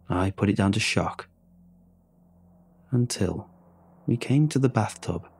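usual speaking voice

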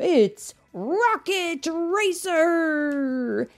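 loud, high, slow arena hype; announcer call. Acted. The announcer introduces Rocket Racer to the stadium, loud and slow. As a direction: draw out the name for the crowd. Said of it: "The announcer continued".

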